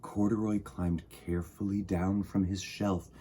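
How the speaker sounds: story narration. A narrator's reading. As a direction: read to children, unhurried. Reading picture book aloud.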